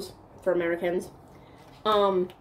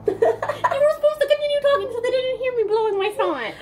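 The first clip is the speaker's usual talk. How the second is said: very high, giggly protest; exasperated squeal; squealed complaint; 13 semitones higher, 3 dB louder than usual. Real, not acted. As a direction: whine it up high at your friend. Mock complaint to friend, after laughing.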